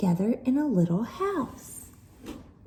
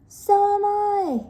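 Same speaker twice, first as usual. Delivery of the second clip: much higher, louder slow yell; 12 semitones higher, 6 dB louder than usual. Acted. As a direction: yell back. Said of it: "yelled Gilda".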